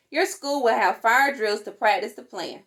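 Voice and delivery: ordinary delivery, nothing marked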